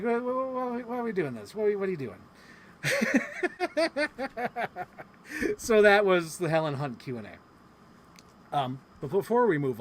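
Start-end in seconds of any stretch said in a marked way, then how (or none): 0.0-2.2 stammered mock protest, pitched up; agitated. Imitating an actress questioning the game, agitated. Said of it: "just seemed so agitated". 2.8-5.0 high-pitched run of laughter; real laugh. Real, not acted. Host amused by the flustered actress story, laughing.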